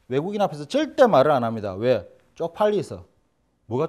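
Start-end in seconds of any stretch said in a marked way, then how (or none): none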